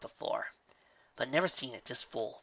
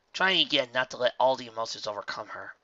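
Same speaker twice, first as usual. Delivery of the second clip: usual speaking voice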